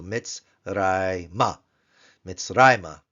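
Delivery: Mitzrayma with RAY stressed; teacher stressing the penultimate syllable; stress on the penult. Shown as a demonstration. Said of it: "to bring out the accent on the P note".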